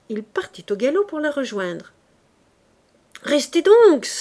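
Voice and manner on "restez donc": loud, higher command; shouted order. Acted. Sergeant yelling at Fabrice to stay, loud shout. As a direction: bark it after the rider. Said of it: "lui cria le maréchal des logis".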